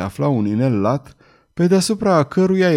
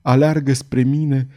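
ordinary delivery

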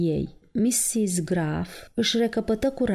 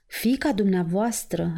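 ordinary delivery

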